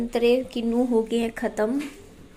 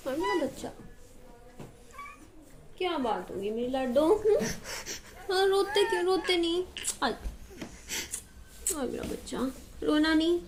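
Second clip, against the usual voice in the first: soft, higher coaxing hush; 5 semitones higher, 8 dB quieter than usual. Real, not acted. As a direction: gently coax the little one to stop crying. Mother comforting crying son. (रोते बच्चे को पुचकारते हुए, धीमी ऊँची आवाज़ में)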